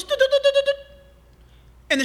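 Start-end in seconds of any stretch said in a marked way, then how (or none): 0.0-0.7 much higher whistle-like du-du; shepherd whistling to call his sheep, high and bright; imitated shepherd whistle. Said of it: "certain whistles".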